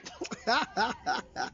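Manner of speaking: soft helpless laughter; breaking into a quiet laugh; real laugh. Real, not acted. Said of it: "Sorry, I'm laughing".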